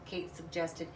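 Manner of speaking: ordinary delivery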